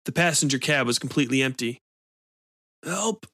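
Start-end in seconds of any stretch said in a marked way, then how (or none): 2.8-3.3 weak high moaned plea, much softer; faint moan. A narrator's reading. Injured man calling weakly from behind the truck, moaning. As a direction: moan it from the ground. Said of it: "moaned a voice".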